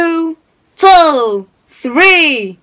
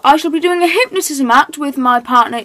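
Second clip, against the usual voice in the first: ordinary talk, nothing marked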